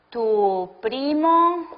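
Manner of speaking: word by word, with long gaps between words; slow dictation. Shown as a demonstration. Teacher to class, dictating an example sentence slowly. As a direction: dictate it slowly so students can write.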